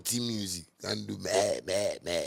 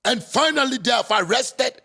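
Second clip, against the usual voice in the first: much higher and louder, hammed-up anguish; mock pained reporter; 9 dB louder than usual. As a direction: parody the wailing news voice. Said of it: "talk with pain".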